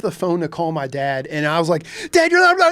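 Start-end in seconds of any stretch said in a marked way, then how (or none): 2.1-2.6 high, loud frantic yell; screaming at dad. Retold or imitated. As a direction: yell it down the phone. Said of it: "and I'm screaming".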